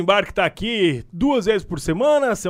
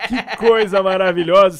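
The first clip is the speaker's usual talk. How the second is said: laughing with delight; 3 semitones higher, 5 dB louder than usual. Real, not acted.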